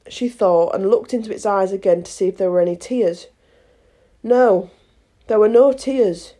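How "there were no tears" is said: ordinary delivery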